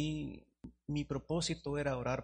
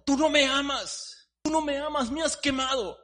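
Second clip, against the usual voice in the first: anguished teacup; 13 semitones higher, 11 dB louder than usual. Acted. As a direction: cry out, burned. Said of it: "teacup accuses potter".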